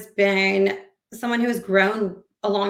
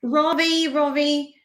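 higher-pitched, drawn-out name call; playful take-off of a friend's higher voice; imitating Ravi; 6 semitones higher, slower than usual. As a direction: put on your friend's voice, lifted and held out. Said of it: "he talks like this".

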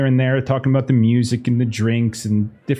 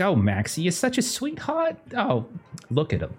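higher, gushing hostess cooing over Maxie; doting socialite; 6 semitones higher than usual. Acted. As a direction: coo over him, soothe the guest. GM voicing doting Evelyn.

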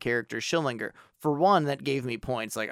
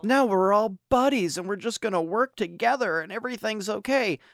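higher, sing-song sarcastic cheer; much higher, sugary mock voice; mock sitcom sweetness; 8 semitones higher than usual. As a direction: parody a saccharine group hug moment. Mocking the sitcom reconciliation scene.

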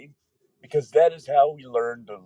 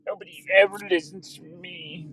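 higher sulky cartoon kid; vintage cartoon voice; 6 semitones higher, slower than usual. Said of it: "Do a cartoon voice, son".

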